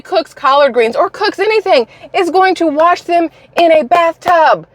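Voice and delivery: high-pitched, incredulous disgust; emphatic, appalled rant; disgusted outrage. Real, not acted. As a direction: let the pitch climb as you rail in disgust. Disgusted rant over bathtub washing.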